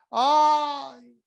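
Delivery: theatrical self-pitying sigh; hammed-up ay; mock lament. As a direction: sigh like a martyr. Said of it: "hasta que digo".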